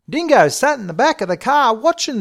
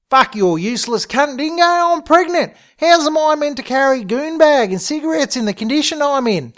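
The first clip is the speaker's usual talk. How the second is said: high, indignant outburst; angry berating; 9 semitones higher, 4 dB louder than usual. Acted. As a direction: tear into him, voice pitched right up. Sheila berates Dingo in acted quarrel.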